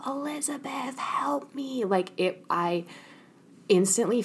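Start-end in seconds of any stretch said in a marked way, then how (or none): 0.1-1.8 high, soft pleading whisper, imitating dad; father's plea. Daughter imitating her father begging for help, high breathy whisper. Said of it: "he was like".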